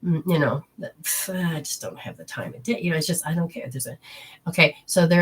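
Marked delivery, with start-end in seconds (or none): 0.0-3.7 indifferent. Retold or imitated. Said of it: "a feeling of just indifference".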